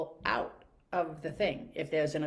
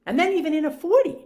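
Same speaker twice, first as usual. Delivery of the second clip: fed-up driver, louder and higher; exasperated outburst; 10 semitones higher, 10 dB louder than usual. Real, not acted. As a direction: pitch jumps high and loud on "forty". Ranting about slow drivers.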